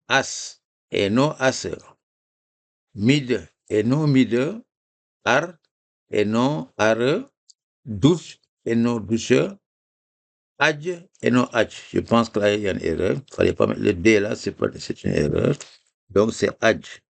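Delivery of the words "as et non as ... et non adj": "As", "Mid", "Douce", "Adj" said slowly, each word short then the wrong long version; slow right-and-wrong readings. Shown as a demonstration. Teacher drilling right versus wrong readings, slow. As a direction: contrast clipped and drawn-out readings slowly. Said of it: "vous voyez, c'est full et non full".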